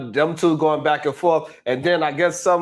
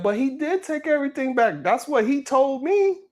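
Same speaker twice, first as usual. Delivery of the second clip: higher protesting voice; 8 semitones higher than usual. Retold or imitated. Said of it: "Ilkan said".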